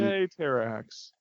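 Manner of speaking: slow, low, quiet mock cheer; unenthusiastic cheer. Real, not acted. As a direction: cheer with no energy at all. Mock cheer at villain's return.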